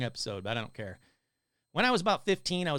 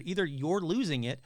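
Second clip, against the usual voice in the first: ordinary delivery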